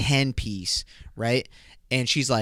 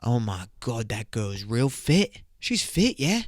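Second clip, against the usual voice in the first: mock British accent. British person spots a fit girl, mock British accent. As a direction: play an admiring British lad. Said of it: "a British person would be like".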